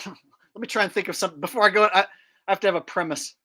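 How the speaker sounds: normal talking voice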